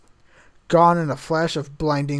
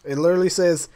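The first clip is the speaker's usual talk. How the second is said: plain reading voice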